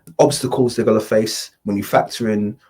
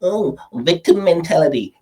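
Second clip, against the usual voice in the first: mocking commenter; 6 semitones higher than usual. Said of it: "you're gonna get your group of incels".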